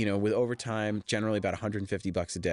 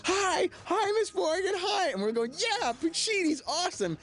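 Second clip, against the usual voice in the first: very high, squeaky dopey fanboy voice; goofy fans; slower than usual. Retold or imitated. Extras greeting the star, very high dopey fan voice. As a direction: squeak it like a simple eager fan. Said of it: "We're like".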